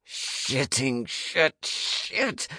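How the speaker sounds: slow, strained growl rising in pitch; frustrated, drawn-out cursing. A narrator's reading. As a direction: drag the curse out through a growl. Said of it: "I growled".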